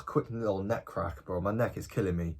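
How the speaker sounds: normal talking voice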